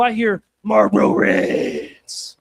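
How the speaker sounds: slow, low, loud trashy drawl. Acted. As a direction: let the trailer park voice take over.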